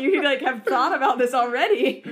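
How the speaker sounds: loud, real laughter through the words; laughing hard. Real, not acted. Host amazed at the quick answer, laughing hard. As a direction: crack up while talking.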